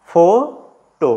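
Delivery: "Photo" very slowly, ফো, long pause, টো; split into syllables. Shown as a demonstration. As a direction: stretch ফো and set টো apart. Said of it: "ভাগ করে দিই".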